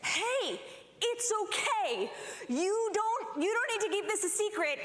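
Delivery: lightly voiced, high, placating teacher; calm the child down, a bit flustered; teacher placating the toddler, high and soothing. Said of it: "she says".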